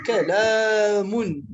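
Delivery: كلام slow, its long aa held steadily; drawn-out syllable. Shown as a demonstration. Teacher repeating the example for students, كلام drawn out. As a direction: stretch the middle vowel of كلام without breaking it. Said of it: "parce que le son n'a pas tourné".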